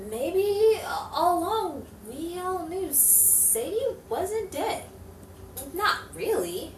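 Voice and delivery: reflective detective narration, measured; hardboiled narrator voice; noir voice-over. A narrator's reading. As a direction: muse back on the case to the listener.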